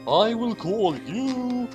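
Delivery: ordinary talk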